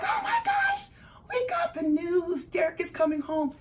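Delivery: high-pitched, squealed good news; ecstatic announcement. Retold or imitated. Wife calling husband with parole news, ecstatic.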